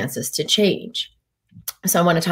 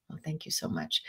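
whispered thanks; 2 semitones lower, 17 dB quieter than usual. Real, not acted. Reading viewer thanks.

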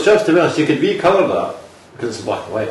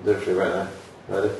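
ordinary delivery

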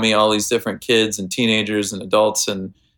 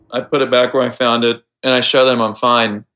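normal talking voice

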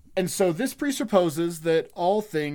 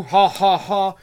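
fake sarcastic laugh; 2 semitones higher, 7 dB louder, slower than usual.

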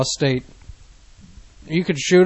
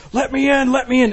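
put-on high, slurred drunk plea; imitating a drunk yelling; drunk stupor voice; 8 semitones higher than usual. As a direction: wail it like a drunk at the door. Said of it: "in this drunk, stupor voice".

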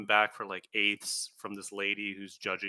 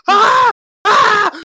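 very high, loud mock screams; panicked screaming; 21 dB louder than usual. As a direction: shriek like a crowd freaking out. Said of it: "they're freaking out".

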